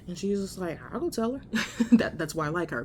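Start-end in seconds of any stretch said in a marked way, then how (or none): 0.9-1.4 higher, imitated nurse with no qualms; blunt nurse, unbothered. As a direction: toss it off as the unfazed nurse. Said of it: "she doesn't give a fuck".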